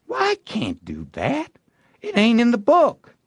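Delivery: refuse, pointing at the rules; protesting boy. A narrator's reading. Tom refusing to die against the book, high protest.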